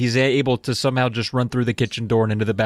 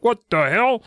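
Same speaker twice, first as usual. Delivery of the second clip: shocked bully; 6 semitones higher than usual. Retold or imitated. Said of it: "He's like".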